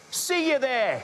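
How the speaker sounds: high, drawn-out cheery call; calling a farewell. Acted. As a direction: sing it out as you sign off. Cheery sign-off called to listeners.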